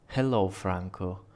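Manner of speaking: ordinary delivery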